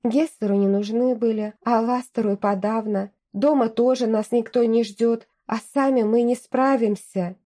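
ordinary delivery

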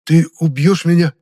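high, loud, frightened question; alarmed, panicky disbelief; courage suddenly lost. A narrator's reading. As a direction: ask aghast, all bravado gone. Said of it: "вмиг растерял Хаосил всю свою смелость".

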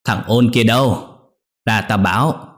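put-on old mother calling her son to come up. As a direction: call the son from across the yard. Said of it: "để gọi lớn".

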